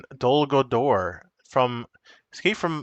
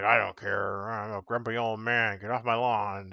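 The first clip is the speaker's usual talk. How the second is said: put-on grumpy old man; 2 semitones lower, 3 dB quieter than usual. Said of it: "he's like".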